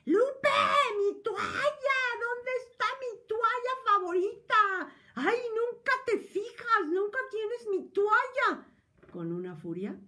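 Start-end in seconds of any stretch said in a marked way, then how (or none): 0.0-8.7 much higher, much louder shrieking husband, furious; hysterical furious yell. Retold or imitated. Ambivalent husband shrieking at wife Lupe over his towel, furious hysterical yell. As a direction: shriek at her like a spoiled husband throwing a fit. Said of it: "te da un alarido histérico".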